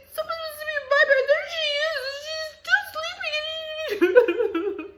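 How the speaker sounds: shrieking high crying whine; wailing little cub; whiny baby bear. A narrator's reading. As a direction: blubber it out, rushing then dragging. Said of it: "What a whiner".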